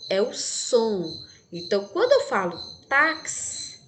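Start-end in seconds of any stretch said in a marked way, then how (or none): 2.9-3.8 "táxi" with the X heard as K-S. Shown as a demonstration. Teacher contrasting sound and spelling, "táxi" with KS. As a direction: stress the ks inside "táxi". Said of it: "vejo que o som é diferente".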